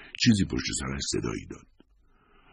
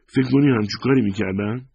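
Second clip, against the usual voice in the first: higher, louder fierce retort as Lisa; wife hurt and fierce, higher and louder; 8 semitones higher, 6 dB louder than usual. A narrator's reading. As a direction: snap back, stung and fierce. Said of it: "لیزا با خشونت گفت".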